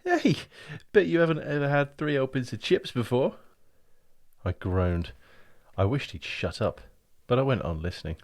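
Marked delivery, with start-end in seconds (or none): 0.0-3.4 much higher, louder, thrilled; excited gushing. Acted. Brian reliving the outing to his brother, excitedly. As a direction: gush with delight.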